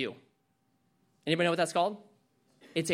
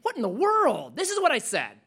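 wife objecting; 7 semitones higher than usual. Pretend. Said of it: "gives your wife a chance to say".

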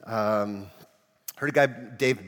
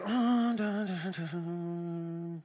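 distant Jesus; 4 semitones higher, 3 dB quieter than usual. Acted. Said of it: "He'd be distant".

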